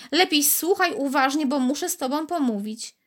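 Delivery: high little voice, mildly impatient. A narrator's reading. Bead impatiently tells Karolcia to listen, mild. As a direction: urge her to listen. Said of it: "głosik był teraz zniecierpliwiony".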